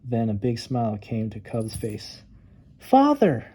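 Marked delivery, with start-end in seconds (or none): 2.8-3.5 much higher, happy call; joyful cry. Acted. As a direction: cry out in delight. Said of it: "cried Cub".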